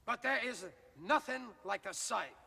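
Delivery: normal talking voice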